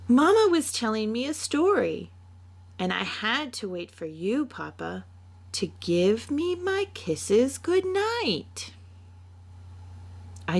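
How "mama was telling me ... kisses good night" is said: put-on a child pleading for goodnight kisses; excited little girl. Little Mina telling her papa why she is still up, in a high child voice.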